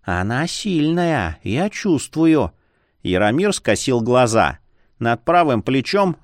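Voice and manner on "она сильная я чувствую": slow, thin mosquito voice; drawn-out, higher little voice; tiny squeak. A narrator's reading. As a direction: voice a tiny creature warning in your ear. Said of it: "раздался тоненький писк".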